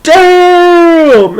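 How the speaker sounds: high loud crowd damn; crowd cheer. Retold or imitated. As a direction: shout like onlookers at a big hit. Crowd reacts to tackle.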